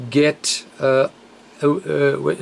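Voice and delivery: normal talking voice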